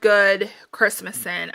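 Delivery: ordinary talk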